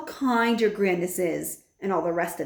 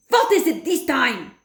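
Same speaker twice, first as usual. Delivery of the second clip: louder, higher witch shout; 6 semitones higher, 6 dB louder than usual. A narrator's reading. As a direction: bark it like an irritated witch. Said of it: "shouted the Grand High Witch".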